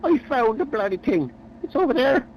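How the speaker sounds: high, eager announcement; excited. Acted. Eric thrilled at the discovery.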